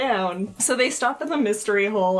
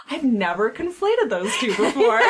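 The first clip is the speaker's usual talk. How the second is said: words through real laughter; laughing while talking; 3 dB louder than usual. Real, not acted. Laughing at friend's joke.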